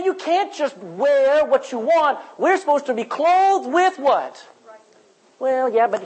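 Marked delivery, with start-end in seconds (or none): 0.0-4.4 slow, high, lightly voiced scold; a hint of a scolding teacher; stern teacher. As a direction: lecture the crowd, asking them to fill in the word. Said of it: "draw a line and say".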